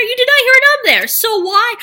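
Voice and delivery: loud, high and fast, mock outrage; indignant. Real, not acted. Scolding viewers who missed the voicemail, high fast indignant. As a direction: fire it back in high-pitched disbelief.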